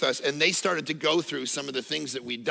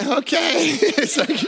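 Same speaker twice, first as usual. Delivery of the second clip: higher, laughing nervous okay; 8 semitones higher, 4 dB louder, slower than usual. Retold or imitated. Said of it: "the girl's like".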